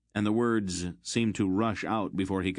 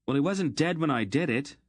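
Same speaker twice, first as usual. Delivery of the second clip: higher, louder angry outburst; angry shout; 8 semitones higher, 3 dB louder than usual. A narrator's reading. Geryon shouting at Aunt Paul over the dead slave, angry. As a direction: shout back at her in fury. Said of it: "Geryon shouted at her".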